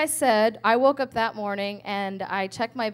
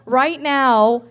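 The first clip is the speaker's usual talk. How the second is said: heated outburst; 2 semitones higher, 7 dB louder than usual. Retold or imitated. Threatening to call the ministry.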